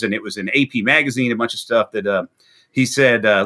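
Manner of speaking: ordinary talk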